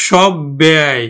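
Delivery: সব ব্যয় with the b held double; doubled b. Shown as a demonstration. Teacher showing students the doubled consonant, doubled b. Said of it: "এই জন্য কিন্তু উচ্চারণ হবে".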